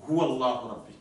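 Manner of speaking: ordinary talk, nothing marked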